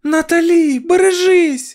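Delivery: high, loud cry of alarm; very high urgent shout; shouted warning. A narrator's reading. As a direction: scream out to warn the child. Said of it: "крикнула вона".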